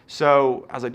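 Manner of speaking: normal talking voice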